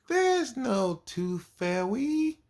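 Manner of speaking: high, slow, drawn-out mimic of a little girl; mocking the girl. Teacher mimicking the girl who ruins everything, high and slow. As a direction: stretch it out high like a know-it-all kid. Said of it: "that's how she says".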